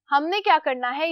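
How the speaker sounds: normal talking voice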